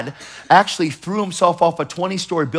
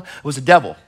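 ordinary talk, nothing marked

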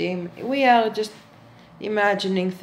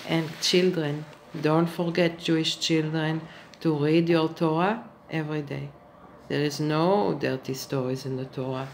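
softer, lower, sweetly instructing; soft children's-host voice; 6 semitones lower, 6 dB quieter than usual. Acted. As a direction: coax the little ones gently. Addresses children softly.

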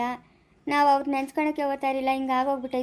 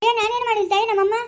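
higher, louder, panicked protest then calls; frantic pleading; 6 semitones higher, 5 dB louder than usual. Acted. Telling the mother she did nothing, frantic and loud. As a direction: cry out in alarm, defending yourself. Defending herself amid panic.

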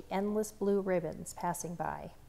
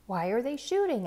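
higher, louder frightened question; scared child; 5 semitones higher, 6 dB louder than usual. A narrator's reading. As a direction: ask in fear of the shooting. Child scared during gunfire.

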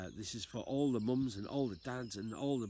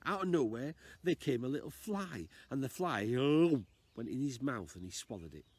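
plain reading voice

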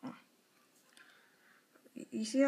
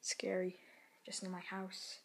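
soft, uneasy whisper; scared whisper; 10 dB quieter than usual. Real, not acted. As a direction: whisper like someone might hear. Alone at home, whispering.

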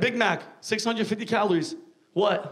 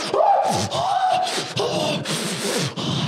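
high, drawn-out theatrical groan; long high exasperated groan; 13 semitones higher than usual. Retold or imitated. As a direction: groan like starving for fries. Exaggerated sigh before quote.

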